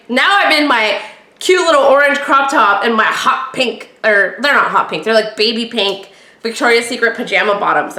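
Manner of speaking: normal talking voice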